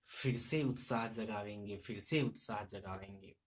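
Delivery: stirring poem recital that fades at the close; poem recitation. Shown as a demonstration. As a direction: recite it to stir the hopeless. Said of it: "अगली पंक्ति हम present करेंगे".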